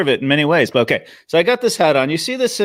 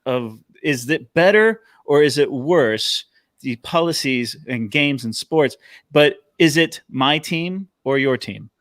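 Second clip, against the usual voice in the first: slow and deliberate; slower than usual. Real, not acted. Slow emphatic point.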